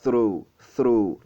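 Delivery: "through" with a breath of air on TH; tongue between teeth for "through", vowel stretched; deliberate teacher's "through", long falling vowel; TH sound demo. Shown as a demonstration. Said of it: "le son que vous aurez, c'est th".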